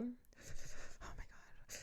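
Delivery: drawn-out, shuddering whisper; pretend freezing. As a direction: breathe it out slowly as if shivering. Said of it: "pretending like I'm freezing".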